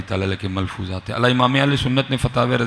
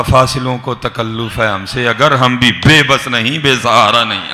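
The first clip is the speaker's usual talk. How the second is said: loud, slow declaimed couplet; forceful poetic delivery; impassioned verse recitation; 2 semitones higher, 7 dB louder, slower than usual. Acted. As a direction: declaim the verse to a congregation, drawn out.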